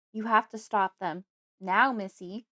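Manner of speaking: father yelling into his daughter's face to act now. Acted. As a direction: shout it at the child, pressing hard. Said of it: "he yells to my face".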